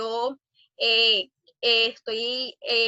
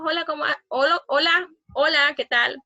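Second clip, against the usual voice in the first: bright, higher, louder hellos; chirpy chat greeting; 6 semitones higher, 4 dB louder than usual. Shown as a demonstration. Teacher mimicking a breezy chat message, higher and louder. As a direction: sing out the greetings like a casual text.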